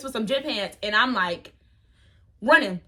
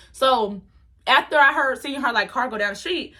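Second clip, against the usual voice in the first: ordinary talk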